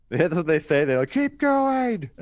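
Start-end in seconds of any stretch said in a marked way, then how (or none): 1.1-2.1 high, loud mock racer's shout; urging yell. Imitating the reckless racers for friends, high urging yell.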